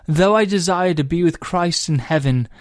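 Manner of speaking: put-on "Though I desire to be with Christ in heaven" in a light British accent; recited in a mild put-on British accent. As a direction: lift "Though I desire" gently, British vowels. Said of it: "I'm gonna do this in an accent".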